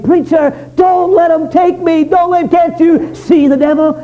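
high, desperate pleading shout; retold terrified scream. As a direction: cry out as the damned man begging the preacher. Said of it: "who was screaming and crying".

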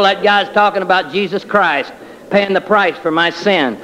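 ordinary delivery

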